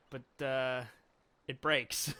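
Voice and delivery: ordinary delivery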